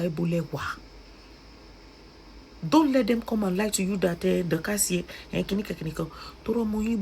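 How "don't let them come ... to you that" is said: ordinary talk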